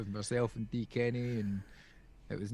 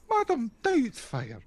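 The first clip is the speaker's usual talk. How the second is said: high, slow mock Scottish granny; Mrs Doubtfire Scottish; 12 semitones higher, slower than usual. Answering Mrs Doubtfire joke, high slow mock Scottish. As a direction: drawl it out like Mrs Doubtfire in Scots. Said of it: "Missus Doubtfire".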